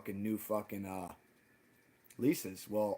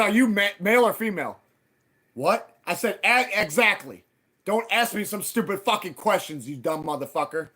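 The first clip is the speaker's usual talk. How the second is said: louder, scornful mock question; retold mockery; 6 semitones higher, 7 dB louder than usual. Mocking the landlord who asks how long he lived there. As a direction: ridicule his stupid question.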